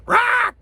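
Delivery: high loud cawing rah; loud high-pitched raven screech. Shown as a demonstration. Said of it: "and the Raven goes".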